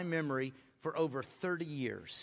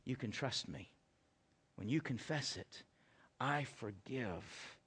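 gentle voice of God; 7 semitones lower, 8 dB quieter than usual. Retold or imitated.

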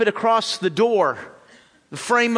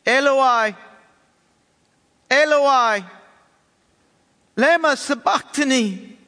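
higher, louder anguished cry; 6 semitones higher, 3 dB louder than usual. A narrator's reading. Jesus cries to God from the cross, a loud anguished cry. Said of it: "cried out with a loud voice".

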